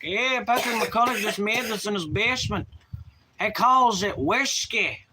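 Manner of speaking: high-pitched put-on Irish accent. Streamer giving chat his Irish example, exaggerated Irish accent. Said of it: "the whole Irish thing is like".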